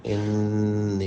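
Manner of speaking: انی with the doubled noon hummed through the nose and held; ghunna on noon. Shown as a demonstration. Teacher showing the ghunna in انی. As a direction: hum the nasal noon in انی. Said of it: "ایک الف کے برابر ہوگا".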